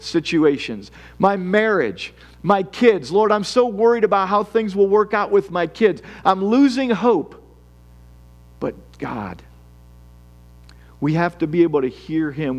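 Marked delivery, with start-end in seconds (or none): 3.1-7.4 higher, pleading worry; plead, acting out mild desperation; worried prayer. Parent praying about their kids, worried. 8.6-9.4 low, soft and solemn; hushed assurance. Acted. Preacher answers someone losing hope, hushed.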